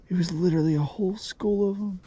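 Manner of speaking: high hushed whisper. Real, not acted. Whispering about the fish school.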